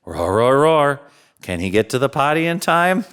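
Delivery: usual speaking voice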